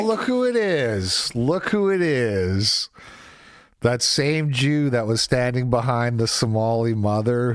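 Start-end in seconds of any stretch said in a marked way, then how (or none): 0.0-1.3 high, sing-song sarcastic greeting; mock sing-song greeting. Streamer mocking a woman on screen, high sarcastic sing-song. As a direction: sneer it out in a high teasing lilt.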